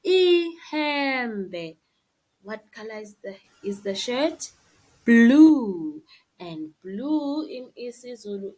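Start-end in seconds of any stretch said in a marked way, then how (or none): 0.0-1.8 Ihembe said slowly and clearly; pronunciation demo. Shown as a demonstration. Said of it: "Then we see ihembe". 5.0-6.0 loud announcement. Shown as a demonstration. Teacher announces answer.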